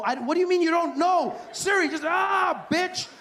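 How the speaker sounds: high, loud exasperated yell; frustrated shout. Retold or imitated. Caller furious at Siri, high loud shouting. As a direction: lose it at the phone.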